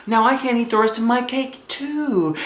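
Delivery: high fake-sad kid voice; scheming little girl. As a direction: pretend to be sad to get the cake. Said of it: "I said".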